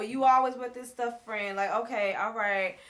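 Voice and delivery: loud, high, scornful mock rant; mocking heated retort. Amara snapping back at Shay, loud mock scorn. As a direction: throw it back at her, fed up and loud. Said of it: "And Mara's like".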